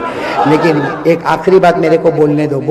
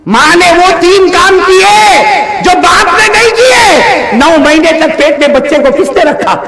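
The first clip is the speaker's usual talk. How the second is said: preaching at full force; 13 semitones higher, 5 dB louder than usual. Acted. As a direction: hammer each line at the crowd. Climactic shouted preaching to crowd. (مجمع سے جذباتی بلند آواز خطاب)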